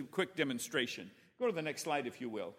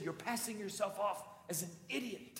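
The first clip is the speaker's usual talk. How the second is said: softer, higher, a laugh under it; dry hushed retort; 3 semitones higher, 7 dB quieter than usual. Retold or imitated. Wife quietly teases her husband, dry hushed retort.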